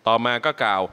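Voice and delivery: normal talking voice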